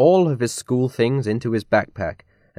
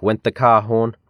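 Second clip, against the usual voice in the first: ordinary delivery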